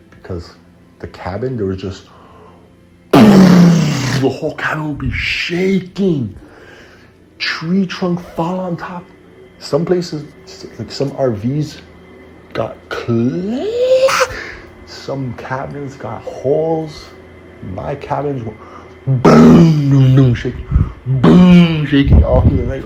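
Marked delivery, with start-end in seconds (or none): 3.1-18.6 high, loud, drawn-out dramatic telling; animated storm retelling. Retold or imitated. Telling a friend how the storm shook camp, animated and loud. Excitedly reliving the ice storm. 19.1-22.8 very loud, high booms; booming crash imitation. Imitating storm hits on the cabin all night, loud and dramatic.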